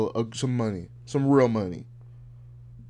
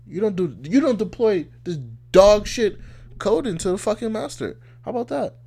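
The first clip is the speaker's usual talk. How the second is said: heated argument; 8 semitones higher, 5 dB louder than usual. Retold or imitated. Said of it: "You're like".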